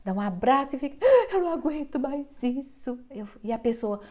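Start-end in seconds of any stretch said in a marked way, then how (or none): 1.0-3.0 louder, higher whiny wail; mock lament. Anxious girl clinging to her carer, mock wail. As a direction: wail it like you can't take it.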